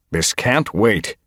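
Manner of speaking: slow, firm insistence; insistent. A narrator's reading. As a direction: refuse to budge on it. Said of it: "Tigerstar insisted".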